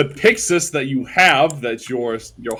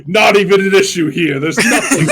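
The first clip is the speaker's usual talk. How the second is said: laughing; 6 semitones higher, 7 dB louder than usual. Acted. God speaks while narrator laughs.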